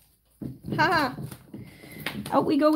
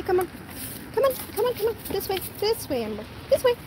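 high coaxing call; 6 semitones higher, faster than usual. Real, not acted. Calling puppy inside.

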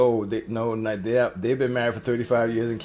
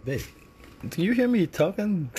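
usual speaking voice

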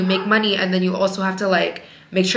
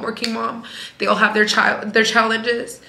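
normal talking voice

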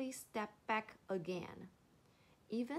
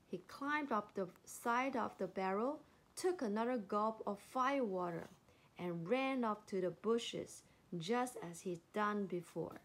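ordinary delivery

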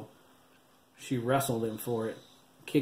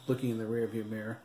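usual speaking voice

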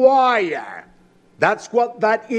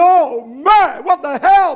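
louder, higher startled outburst; a father stunned by the explosion; shocked exclamation; 7 semitones higher, 7 dB louder, slower than usual. Retold or imitated. Father reacting to the blast beside his child, shocked outburst. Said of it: "he was talking like that then".

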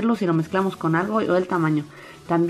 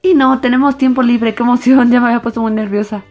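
excited; 3 semitones higher, 5 dB louder than usual. Real, not acted. Happy about free time result.

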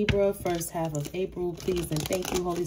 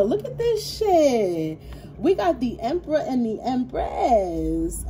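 high and slow with delight; amazed; 6 semitones higher, slower than usual. Real, not acted. The reader shows viewers the cards she drew, in high, slow amazement. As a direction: draw it out, wowed.

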